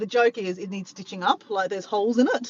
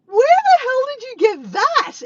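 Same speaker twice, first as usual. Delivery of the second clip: very high, loud imitated demand; loud, squealing retold disbelief; mock incredulous yell; 9 dB louder than usual. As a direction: shriek it as an amazed friend would.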